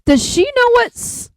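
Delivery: baffled, voice shooting way up; incredulous, slow then leaping very high; high-pitched disbelief. Real, not acted. Baffled reaction to friend's story.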